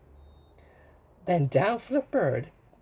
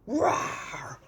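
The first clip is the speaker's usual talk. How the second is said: 1 dragon roar; 8 semitones higher than usual. Acted. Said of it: "a roar that was scary and loud".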